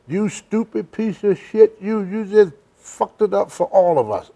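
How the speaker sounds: put-on old wrestler scolding. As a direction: tear into him like an angry veteran. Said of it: "he said".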